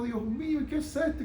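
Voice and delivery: high, soft imitation of crying out to God; re-enacted weeping plea; tearful. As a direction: plead softly in a high, mock-tearful voice. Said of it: "me fui a mi casa a llorar".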